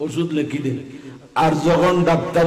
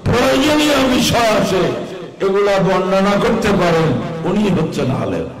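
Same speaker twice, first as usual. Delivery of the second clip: preaching; 4 semitones higher than usual. Acted. Preacher defines a true scholar.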